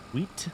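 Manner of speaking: "Wheat" ending in a clear T, not a D; pronounced final T. Shown as a demonstration. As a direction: test "Wheat" with the last T sounded. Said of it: "Do they just pronounce the T?".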